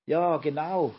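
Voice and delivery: emphatic, lightly shouted backing; calling out. A narrator's reading. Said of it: "rief Olchi Opa".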